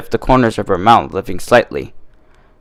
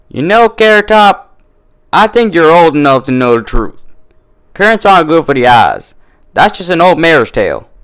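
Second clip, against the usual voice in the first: higher, louder sharp comeback; retort; 6 semitones higher, 5 dB louder than usual. A narrator's reading. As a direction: fire it back at her rival. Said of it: "Applejack retorted".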